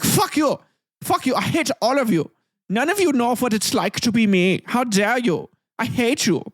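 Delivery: high-pitched mock outburst of hatred; defiant condemned rebel. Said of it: "reportedly remained defiant to the end".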